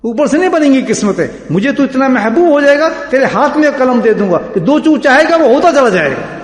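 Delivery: high, emphatic sermon delivery; fervent preaching. Acted. Preacher voicing God handing over the pen, fervent. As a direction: proclaim the promise with full force. Preacher voices divine promise.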